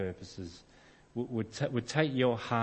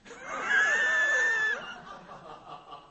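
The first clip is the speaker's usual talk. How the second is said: big burst of laughter. Real, not acted. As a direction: throw your head back and roar with laughter. Laughs at own stubbornness.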